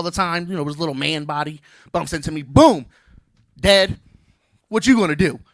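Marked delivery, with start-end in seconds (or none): 3.6-5.4 put-on louder taunting kid voice; a hint of a cocky son. Son bumps into dad, loud teasing kid. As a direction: square up like a little tough guy.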